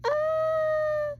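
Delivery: very high, loud squeal; excited shriek. Real, not acted. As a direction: shriek with delight. Thrilled fan reaction.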